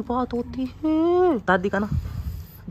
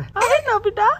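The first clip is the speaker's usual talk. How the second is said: high falsetto wife voice, a bit louder; mimicking wife; 13 semitones higher, 3 dB louder, slower than usual. Husband mimics his wife to the camera, high falsetto. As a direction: squeak it out like a pouty wife. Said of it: "mimicry कर रहा हूँ मैं".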